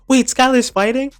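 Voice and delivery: high, loud mock surprise; shocked. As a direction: squeak it out in disbelief. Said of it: "it says".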